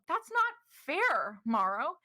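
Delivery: high, loud and slow protest; Ystera protesting. Acted. Ystera answering Morrow's accusation, high and hurt. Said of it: "You hear Ystera's voice in the room go".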